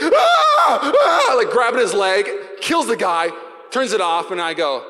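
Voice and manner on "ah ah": very high, loud laughing scream; mock scream. Pastor acting the mauled man, high laughing scream. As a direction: shriek like the man being mauled. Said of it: "and this guy's like".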